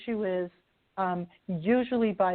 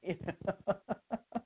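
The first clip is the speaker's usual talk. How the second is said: laugh; 8 dB quieter than usual. Real, not acted. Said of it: "laughs after the joke".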